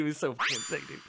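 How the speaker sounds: ordinary delivery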